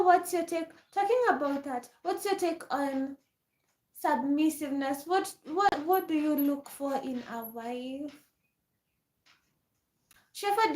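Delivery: ordinary talk, nothing marked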